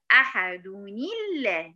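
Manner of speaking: slow أحد نيلة, "ahaduni-llah", with i after the n; noon linked with kasra. Shown as a demonstration. Teacher showing the student how to link the next word, slow أحد نيلة with kasra on the n. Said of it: "هنحركها بالكسر".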